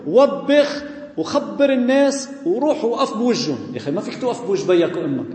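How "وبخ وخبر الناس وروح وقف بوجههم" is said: mock fanatic priest. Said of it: "أو بيجي بيقول".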